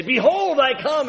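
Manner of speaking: booming proclamation. Retold or imitated. Pastor hammering the pulpit, booming the refrain. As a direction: preach it like you're hammering the pulpit. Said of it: "louder and louder and louder".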